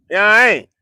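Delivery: hoog en luid uitgeroepen "Yeah, I ain't"; hyped shout. Acted. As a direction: roep het opgewonden. Hyped repeated exclamation.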